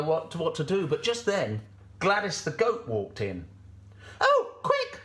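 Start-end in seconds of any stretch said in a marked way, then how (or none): 4.2-5.0 very high, loud sheep squeal; Sally, urgent. Acted. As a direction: urge in a panicky sheep squeak. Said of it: "said Sally".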